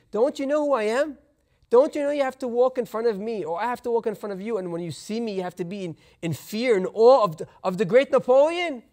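usual speaking voice

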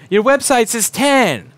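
pointed, drawn-out demand; snippy customer. Retold or imitated. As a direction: complain like an entitled customer. Rude customer complaining.

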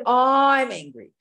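hammed-up self-declaration; mock ego claim. Shown as a demonstration. Teacher mocking the big I, loud and slow. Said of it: "instead of".

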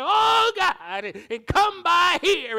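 loud, high, drawn-out churchy wail; mock wailing prayer. Mocking showy prayer to God. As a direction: ham up a dramatic prayer. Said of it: "We don't have to be sitting there".

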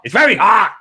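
high, loud hammed-up complaint; mock exclamation. Retelling, repeated with exaggerated emphasis.

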